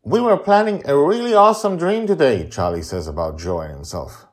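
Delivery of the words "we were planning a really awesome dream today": put-on high, loud eager child; high excited boy's voice; little boy voice. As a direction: pitch it up like an excited kid. Child character Charlie speaks.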